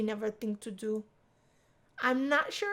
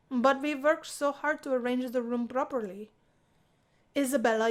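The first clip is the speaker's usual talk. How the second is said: plain reading voice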